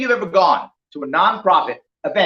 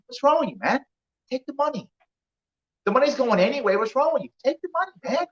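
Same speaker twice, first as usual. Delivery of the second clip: higher, softer needling voice of a shady guy; shady pusher; 7 semitones higher, 4 dB quieter than usual. Retold or imitated. Shady client urges the honest one to take the money, needling. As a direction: coax him like a sleazy pal.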